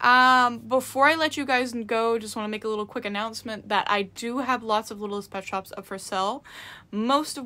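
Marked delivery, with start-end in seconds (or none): none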